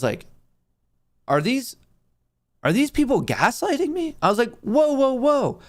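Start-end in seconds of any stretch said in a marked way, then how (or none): 1.3-4.2 high-pitched, incredulous question. Retold or imitated. Acts out disbelief at the lie. 4.6-5.6 high-pitched, mild "whoa" interruption; unheard interjection. Retold or imitated. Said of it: "no one heard me".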